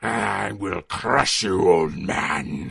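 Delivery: deep, slow, menacing villain growl; low furious threat from the boss; cold rasping evil emperor. Acted. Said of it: "who whips around furious in anger".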